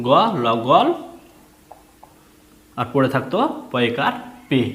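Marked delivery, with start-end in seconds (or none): 0.1-0.9 গ ল, then গল, a bit louder; spelled then joined. Shown as a demonstration. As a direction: spell and blend গল. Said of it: "তাহলে কি হতো". (বানান করে জোড়া)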